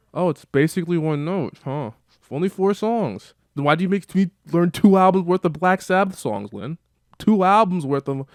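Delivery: mock the lie with a sour, sarcastic edge; sarcastic annoyance. [name] annoyed with Lin.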